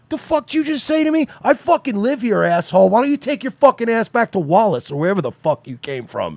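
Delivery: high-pitched, indignant retold tirade; heated mock confrontation; fired up. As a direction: act out a buddy going off on a stranger. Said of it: "you get fired up".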